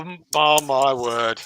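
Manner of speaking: usual speaking voice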